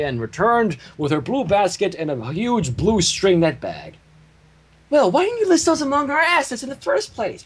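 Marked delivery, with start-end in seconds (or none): 4.9-7.4 grumbling. Acted. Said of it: "grumbled Pinkie Pie".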